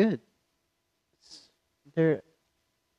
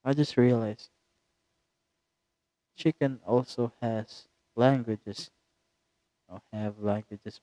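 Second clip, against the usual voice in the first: ordinary delivery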